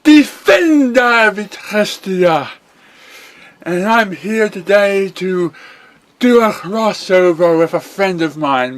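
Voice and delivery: bright, high cartoon pony hero. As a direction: play the chipper young pony hero.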